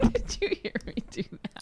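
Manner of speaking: breathless giggling whisper; laughing whisper. Real, not acted. Giggling after her accent bit.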